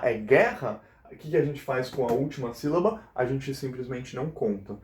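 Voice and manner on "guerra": "guerra" as GUE-rra, ending dropping away; stress on first syllable. Shown as a demonstration. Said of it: "a penúltima sílaba é a sílaba tônica".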